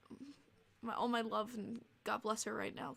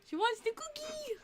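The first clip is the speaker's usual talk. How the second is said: pet talk; 11 semitones higher than usual. Real, not acted. Streamer coos to her dog about a cookie, high pet talk.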